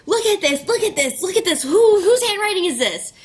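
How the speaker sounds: high, loud, thrilled repeated look at this; bursting with excitement while jumping; excited squeal. Retold or imitated. Jumping, showing flowers to sister.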